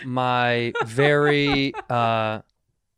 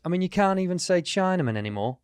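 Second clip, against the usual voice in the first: mock British accent, pitched up; 5 semitones higher, 4 dB quieter than usual. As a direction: imitate a British driver's accent lightly. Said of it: "the accent you just did".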